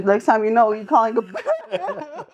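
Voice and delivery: laughing mock threat. Taunting the girl after the fight, laughing mock threat. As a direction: let the laugh take over the warning. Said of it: "I was like".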